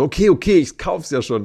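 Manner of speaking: eager friend. Retold or imitated. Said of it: "er war so".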